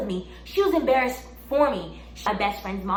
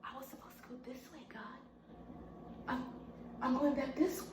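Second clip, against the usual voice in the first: fast whispered aside; 2 semitones higher, 16 dB quieter, faster than usual. Retold or imitated. Replaying her confused walk off stage, fast whisper. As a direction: whisper it to yourself, hurried.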